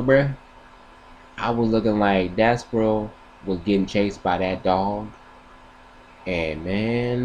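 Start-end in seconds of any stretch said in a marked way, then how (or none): none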